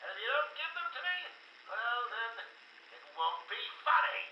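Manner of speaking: very high, manic villain threat; threatening. Acted. Joker threatening Doc over his monsters, high manic menace.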